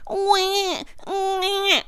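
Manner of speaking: high wailing wah imitation; mock baby cry. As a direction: wail like a newborn. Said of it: "baby crying sound".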